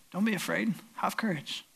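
soft, calming words; gentle reassurance. Acted. Jesus to frightened men in the boat, gentle reassurance. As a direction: reassure them very quietly.

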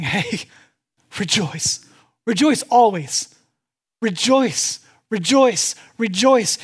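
loud, ringing, joyful repeated call; exuberant command. Retold or imitated. Preacher voicing Paul to the church, loud and joyful. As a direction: shout it out like a joyous order.

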